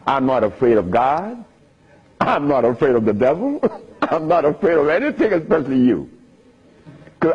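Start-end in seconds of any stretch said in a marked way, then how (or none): none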